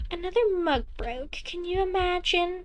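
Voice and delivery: a doting mother, casually pleased; cheery mother voice. Acted.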